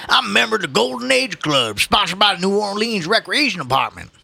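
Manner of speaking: put-on high old-timer's protest; old man, proudly indignant. Old man telling the policeman about his club, indignant.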